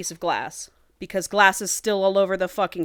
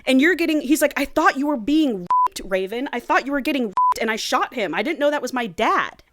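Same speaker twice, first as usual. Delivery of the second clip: mock panic; 6 semitones higher, 5 dB louder than usual. Joey panicking at hospital.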